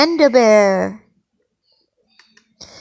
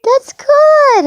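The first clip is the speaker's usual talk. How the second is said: very high, louder cheer; delighted praise; 14 semitones higher, 6 dB louder than usual. Real, not acted. Parent praising a child's pelican, excited.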